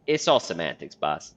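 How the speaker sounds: usual speaking voice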